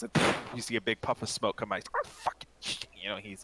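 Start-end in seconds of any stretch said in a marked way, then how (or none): 1.9-2.9 cursing under his breath. Acted. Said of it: "kinda cursing to himself".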